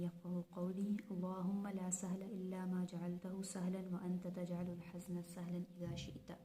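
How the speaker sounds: soft, even recitation of a dua; recited prayer. Real, not acted. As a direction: recite the supplication quietly and devoutly. Recites dua before lesson. (धीमी आवाज़ में दुआ पढ़ना)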